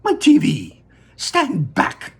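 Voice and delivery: high, loud, urgent Wonka voice; frantic showman shooing kids from camera; Mister Wonka. Acted. Wonka warns Mike Teavee back from the camera, high and loud.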